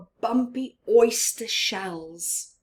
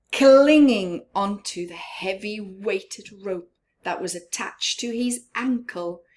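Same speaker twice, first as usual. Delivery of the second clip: ordinary delivery, nothing marked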